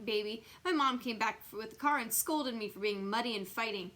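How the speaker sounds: plain reading voice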